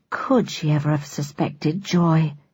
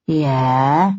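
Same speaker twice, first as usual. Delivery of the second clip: louche drawl; 4 semitones lower than usual. Acted. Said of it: "a louche voice intoned".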